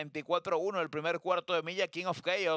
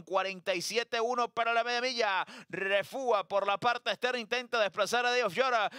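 ordinary delivery

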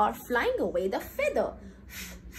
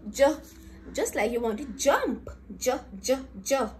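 usual speaking voice

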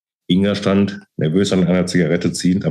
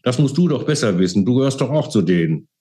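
high-pitched agitated reproach; screamed accusation; 6 semitones higher than usual. A narrator's reading. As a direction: throw the blame back heatedly. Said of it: "log sie schreiend".

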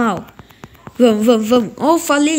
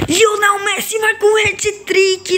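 commentator shout; 9 semitones higher than usual. Real, not acted. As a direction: call the goal like a TV announcer. Narra o gol como locutor. (grito de narrador de gol)

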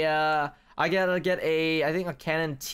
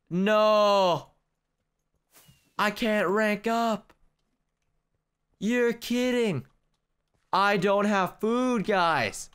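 high, slow, drawn-out whine; despairing whine; 3 semitones higher, much slower than usual. Real, not acted. Player who can't rank up, despairing whine. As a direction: wail each word in gamer despair. Lost progress after the fall.

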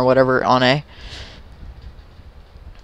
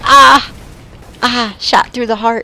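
hammed-up heartbreak; 10 semitones higher, 4 dB louder than usual. Mocking fake heart wound.

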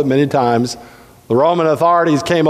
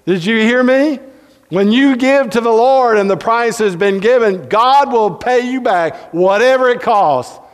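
preacher pressing the point on his congregation; preaching; 5 semitones higher than usual. Acted. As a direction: drive home the promise from the pulpit.

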